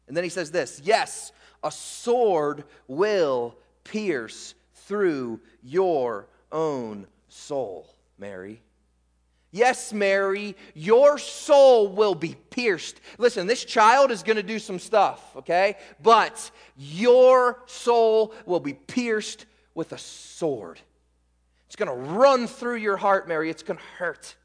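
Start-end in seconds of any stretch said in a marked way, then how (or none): 0.8-8.6 slow, hushed reading of the prophecy, fading low; scripture reading. Acted. 9.5-13.0 slow, louder direct address; preaching. Acted. Pastor to church, speaking as if to Mary, slow and firm. 16.0-23.5 slow, louder direct address; preaching. Acted. Pastor to church, speaking as if to Mary, slow and firm.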